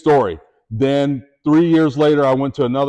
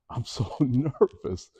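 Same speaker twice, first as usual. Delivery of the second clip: much softer and slow, half laughing; nervous admission; 9 dB quieter, slower than usual. Retold or imitated. Rookie to coach, soft sheepish admission. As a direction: sheepishly confess your nerves.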